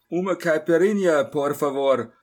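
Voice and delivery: loud call for one drink across the bar. Acted. Narrator calls loudly across to the bar for a drink. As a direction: shout the order over to the counter.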